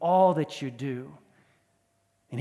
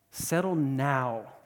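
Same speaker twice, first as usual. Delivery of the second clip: "now" drawn out slowly and weighted; stress on now; much slower than usual. Shown as a demonstration. Pastor stressing "now" to the congregation, slow and weighted. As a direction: lean hard on "now", stretch it out. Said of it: "with emphasis on the word now".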